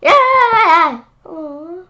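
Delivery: high, loud delighted yelp with a laugh; laughing shout. Real, not acted. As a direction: burst out laughing. Laughing at the gum picture.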